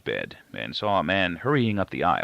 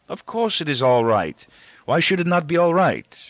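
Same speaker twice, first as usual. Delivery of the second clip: louder, higher insistent repeat; forceful denial; 9 semitones higher, 5 dB louder than usual. A narrator's reading. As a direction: push it harder the second time, almost snapping. Father repeats, more forcefully.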